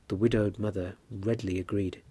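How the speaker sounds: ordinary delivery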